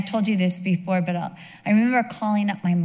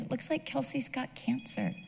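choked-up whisper; 4 semitones higher, 12 dB quieter than usual. Retold or imitated. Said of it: "I was crying so hard".

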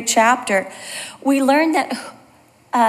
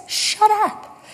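higher-pitched mock retort; retold snapping retort; 7 semitones higher than usual. Cyclist to walker in a quarrel, mock retort. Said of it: "And he says".

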